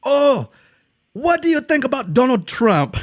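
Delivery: high-pitched imitation of a shouted question; shouting French stranger. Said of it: "question to just shout at people".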